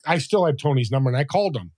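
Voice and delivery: ordinary delivery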